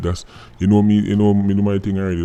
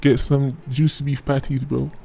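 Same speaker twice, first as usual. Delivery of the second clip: put-on higher, friendly American tourist accent; mock American tourist; 7 semitones higher than usual. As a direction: play the polite American visitor asking directions. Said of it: "If Billy Joe walk up to him and say".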